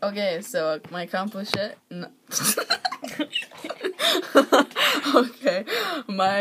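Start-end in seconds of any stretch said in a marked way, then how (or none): 2.3-5.1 high laughter. Real, not acted. Laughing at own words.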